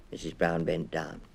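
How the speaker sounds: ordinary delivery, nothing marked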